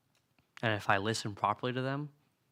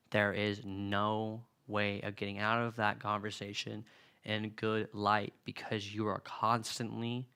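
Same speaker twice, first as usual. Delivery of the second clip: ordinary delivery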